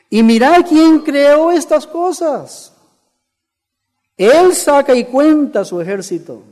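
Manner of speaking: loud, high, ringing proclamation; preaching scripture reading. Acted. Preacher reading the verse to the congregation, forceful proclamation.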